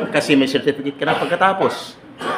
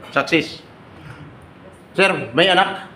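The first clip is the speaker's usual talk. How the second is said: ordinary talk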